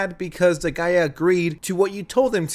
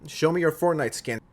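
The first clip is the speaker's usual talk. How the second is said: normal talking voice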